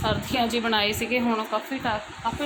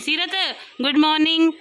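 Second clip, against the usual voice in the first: calling out; 8 semitones higher than usual. Real, not acted. Mother greeting her inattentive child, calling out.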